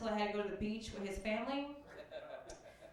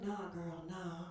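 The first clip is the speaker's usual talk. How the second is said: lower, quiet, lazy drawl of a street guy; homeless guy voice; 7 semitones lower, 8 dB quieter, much slower than usual. Retold or imitated. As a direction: sweet-talk her in a low voice. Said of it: "He was like".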